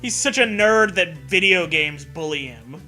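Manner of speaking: ordinary delivery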